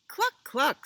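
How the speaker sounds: high, loud double cluck; clucking cow. Acted. Cow greets Cat in a story, high clucking. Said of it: "said Cow".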